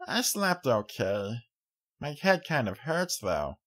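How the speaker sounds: put-on small child, a bit sore; young filly, drowsy. A narrator's reading. Child character voice.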